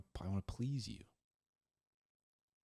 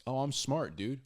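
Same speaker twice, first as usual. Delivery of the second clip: higher, breezy bragging dude; mock boastful bro; 5 semitones higher, 3 dB louder than usual. Preacher voicing a guy claiming he is smart, breezy brag. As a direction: brag lightly, a bit full of yourself.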